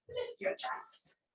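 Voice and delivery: high, soft, slow whispery wizard voice; hammed-up whispered wizard cue, drawn out; Gandalf voice. Yoga teacher cueing the class in a mock wizard whisper. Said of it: "I don't know what accent that was".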